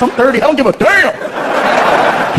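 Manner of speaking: ordinary delivery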